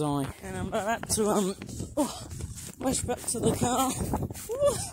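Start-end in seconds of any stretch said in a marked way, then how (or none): 4.5-4.8 high, breathless oh my goshes, real alarm; startled and out of breath, pitch way up; panicked gasps. Real, not acted. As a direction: gasp it out high in sudden panic. Rushing back to the car.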